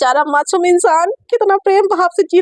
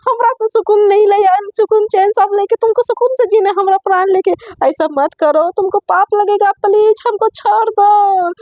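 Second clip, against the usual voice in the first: desperate begging; 7 semitones higher than usual. Acted. Satya begs Aisha to let him go, high whining plea. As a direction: beg her to let you go.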